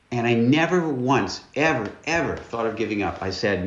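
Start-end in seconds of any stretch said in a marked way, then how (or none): none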